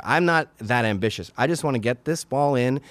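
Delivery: ordinary talk, nothing marked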